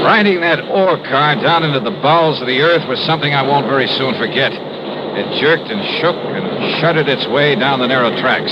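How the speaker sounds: radio detective narrating the descent; story narration. A narrator's reading. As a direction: recount the ride like a hard-boiled narrator. Narrator recounts the ride down.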